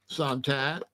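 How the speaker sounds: ordinary talk, nothing marked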